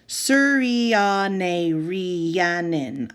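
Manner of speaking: very slow, each syllable of "Suryane Rhiannon" drawn out; slowly. Retold or imitated. Ravi slowly teaching the teacher his name. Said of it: "I say slowly".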